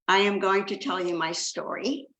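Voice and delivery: ordinary talk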